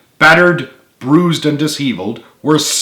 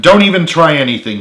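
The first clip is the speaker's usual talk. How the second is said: stern; 5 semitones higher, 5 dB louder, faster than usual. A narrator's reading. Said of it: "he added sternly".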